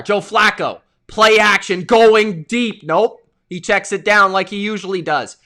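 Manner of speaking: loud, punchy sports call; live play-by-play. Real, not acted. Streamer calling the quarterback's pass play, live play-by-play.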